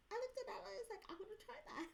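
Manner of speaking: high, giggly retelling with a British-free lightness; amused. Retold or imitated. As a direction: let a laugh lift the voice high. Amused retelling of own eagerness.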